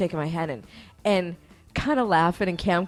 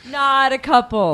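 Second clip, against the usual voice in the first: loud, slow, raised repeat; emphatic denial; 5 semitones higher, 8 dB louder, slower than usual. Retold or imitated. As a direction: hammer the denial home slowly.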